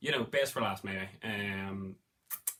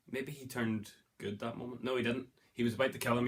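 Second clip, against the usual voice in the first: usual speaking voice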